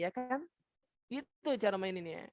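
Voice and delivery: normal talking voice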